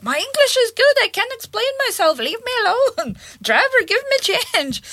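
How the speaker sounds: high-pitched, hammed-up protest; indignant insistence. Russian lady refusing help and demanding change, high mock indignation.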